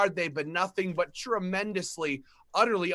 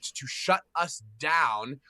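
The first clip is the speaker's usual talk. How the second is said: ordinary talk, nothing marked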